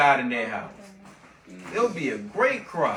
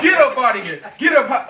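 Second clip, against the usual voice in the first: higher, louder, laughing get out; laughing mock shout; 7 semitones higher, 6 dB louder, faster than usual. Pharaoh yelling at Moses to get out, higher and laughing. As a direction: chase them off through laughter.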